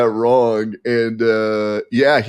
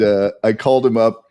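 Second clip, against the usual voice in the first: usual speaking voice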